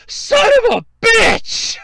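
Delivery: furious at being beaten to it; furious shout. Retold or imitated. Shouting at the guy who grabbed the safe, furious. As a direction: yell it out, high and loud. Angry outburst at man taking safe.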